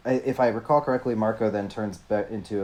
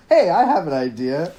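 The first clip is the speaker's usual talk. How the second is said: high, loud, fake-sweet suggestion with laughs; sarcastic friend pretending calm; mock-cheerful Marco; 8 semitones higher, 6 dB louder than usual. Marco confronting Cassie, high mock-sweet sarcastic suggestion. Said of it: "Marco clocks it immediately and is like".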